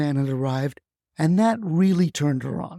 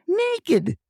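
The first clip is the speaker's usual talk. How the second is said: high-pitched cry of Naked; grandma's high mock yell at rooftops; 12 semitones higher than usual. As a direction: call out Naked high and sharp.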